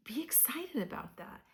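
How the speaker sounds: excited whisper. Real, not acted. Tarot reader urging excitement.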